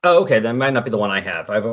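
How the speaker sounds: normal talking voice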